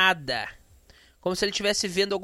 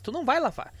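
ordinary delivery

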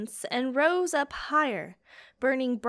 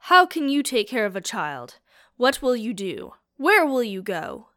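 louder, pressing questions one after another; defiant questioning; 7 dB louder than usual. A narrator's reading. Cornelius challenging the fire spirit despite her wrath, loud and defiant. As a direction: push back hard, demanding answers.

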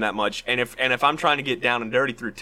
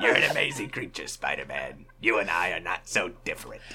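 Green Goblin impression; 6 semitones lower, 5 dB quieter than usual. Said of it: "do our Green Goblin impression".

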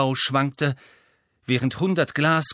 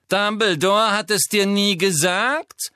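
high, slow, mocking drawl; Malfoy voice; 8 semitones higher, 5 dB louder, slower than usual. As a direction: sneer it out like a cold aristocrat. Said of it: "wiederholte Malfoy".

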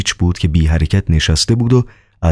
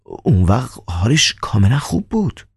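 startled; 6 semitones higher than usual. Acted.

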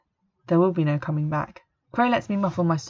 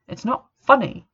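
sharp snap, higher and louder; snapping; 7 semitones higher, 5 dB louder than usual. A narrator's reading. Girl snapping at her friend for snorting, angry. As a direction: bite the words off. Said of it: "I snap".

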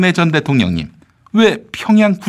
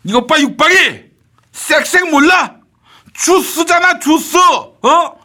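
escalating comedy rant; 14 semitones higher, slower than usual. Acted. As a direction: stretch 쌕주 slowly, shouting. High loud insisting on juice. (높고 크게 우기며 쌕주를 늘려 말함)

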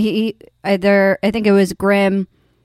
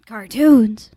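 put-on emphatic "Cartoons", louder on the second syllable; playful echo; 2 semitones higher, 4 dB louder than usual. Co-host echoing today's topic, playful put-on voice. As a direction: echo "Cartoons" in a silly announcing voice.

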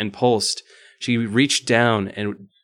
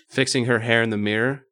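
plain reading voice

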